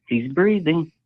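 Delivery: quoted man's exclamation. Retold or imitated. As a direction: imitate a guy calling it out. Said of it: "You hear a guy say".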